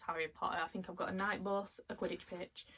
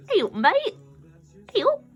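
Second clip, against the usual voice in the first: much higher and louder, a bright hello to a little figure; cheery greeting; 12 semitones higher, 15 dB louder than usual. Acted. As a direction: call out hello to the toy like an old friend. Greeting the pixie ornament.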